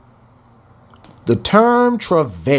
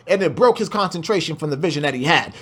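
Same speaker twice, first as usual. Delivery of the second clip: normal talking voice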